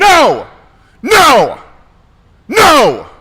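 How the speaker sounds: screamed high and loud; shouting. Real, not acted. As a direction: scream it out, furious. Shouted refusal to Boston.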